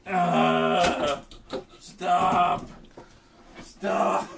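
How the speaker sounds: loud high begging stop; pleading shout. Real, not acted. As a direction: cry out for it to stop. Pleading with the object.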